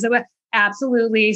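normal talking voice

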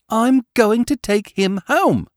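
put-on high child voice, slow and firm; a small boy putting his foot down; determined. A narrator's reading. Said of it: "with a determination which I heartily admired".

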